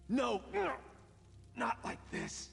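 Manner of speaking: high, desperate cry of terror; terrified scream. Acted. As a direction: scream as death comes. Man killed by assassin.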